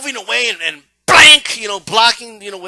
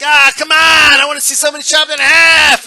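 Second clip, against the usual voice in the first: higher, loud demanding yell; bloodthirsty crowd shout; 6 semitones higher, 7 dB louder than usual. Retold or imitated. Crowd demanding a kill, high loud yell. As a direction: demand gore from the stands. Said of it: "The crowd seeing this, like".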